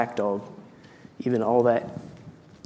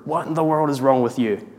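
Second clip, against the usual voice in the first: a hammed-up angry roar, only somewhat louder and higher; thundering voice; 4 semitones higher, 5 dB louder than usual. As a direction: scold the dog with mock fury. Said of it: "His voice thundered in the room".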